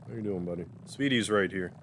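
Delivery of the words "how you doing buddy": hushed, cooing at the cat; soft pet talk. Real, not acted. To Speedy the cat, a soft cooing greeting.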